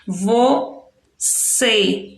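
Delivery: "Você" split in two, weight on cê; stress on last syllable. Shown as a demonstration. Teacher marking the strong syllable, "Você" stressed at the end. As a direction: slowly separate "Você", leaning on the final cê. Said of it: "marcar a sílaba mais forte".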